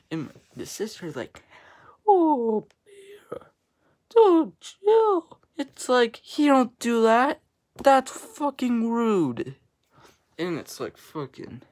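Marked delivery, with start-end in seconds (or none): 2.1-5.4 very high put-on sister voice; mocking sister impression. Sibling mimics sister saying to chill, high and mocking. 5.6-9.6 loud, higher, indignant scolding; indignant rant. Real, not acted. Vlogger fed up with being told to chill, loudly indignant.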